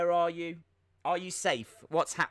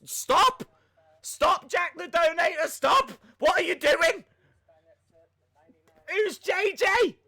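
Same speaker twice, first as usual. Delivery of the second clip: high, loud protest; exasperated shouting; 11 semitones higher, 8 dB louder than usual. Real, not acted. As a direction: yell at the donor to stop, baffled. Begging a donor to stop, bewildered.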